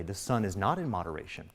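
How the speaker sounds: normal talking voice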